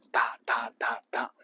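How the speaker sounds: 哒哒哒哒 as four evenly spaced, toneless beats; 哒哒哒哒 as a steady whispered count; four stressed beats. Shown as a demonstration. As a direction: tap out 哒哒哒哒 evenly, one beat each. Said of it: "就四个重音".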